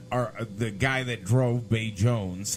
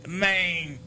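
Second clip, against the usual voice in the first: Jamaican "man"; 7 semitones higher than usual. Retold or imitated. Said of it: "'cause that's how he talks".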